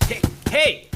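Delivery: sharp high call after someone running off; shouting after him. Acted. Ranger yelling after the fleeing scientist. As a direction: yell to stop him. Jim runs off, David calls.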